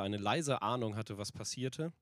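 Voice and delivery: usual speaking voice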